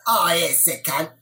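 hammed-up, bad Queensland Australian accent. Shown as a demonstration. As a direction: do your best broad Queensland impression. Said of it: "this is my best Queensland impression".

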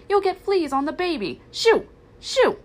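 high, loud scolding with shouted shoos; angry shooing. Acted. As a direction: scold shrilly, chasing the dog away. Aunt Sarah chases Lady off.

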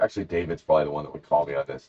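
ordinary talk

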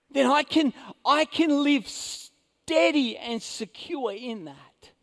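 loud, rising sermon delivery; forceful preaching. Acted. Preacher warning the congregation, loud and driving. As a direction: press the point home to the church.

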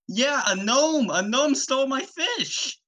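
high-pitched character complaint; Fisherman Joe. Acted. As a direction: insist in a high, hammed-up voice. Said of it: "Fisherman Joe, and he was like".

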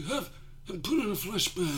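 high, laughing spooky ghost; hammed-up ghostly moan. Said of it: "spooky ghost voice".

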